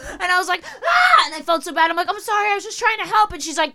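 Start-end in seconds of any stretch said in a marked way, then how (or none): 0.6-1.2 loud, very high frightened scream; acted-out scream of fright; mock scream. Her scream in the story. 2.1-3.3 high, rushed apology; acted-out flustered pleading; panicked apology. Frightened apology to the woman.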